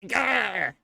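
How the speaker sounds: strangled yelp of defeat; frustrated cry. Acted. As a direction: choke out a frustrated gah. Witness caught in a lie.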